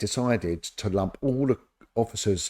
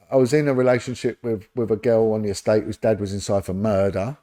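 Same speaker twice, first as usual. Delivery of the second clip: usual speaking voice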